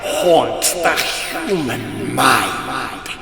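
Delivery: high, slow, croaking monster chant; croaking terrible song. Acted. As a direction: croak it out like a hungry beast gloating. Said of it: "croaking his terrible song".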